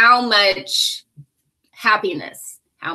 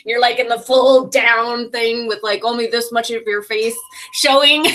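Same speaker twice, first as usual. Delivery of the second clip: usual speaking voice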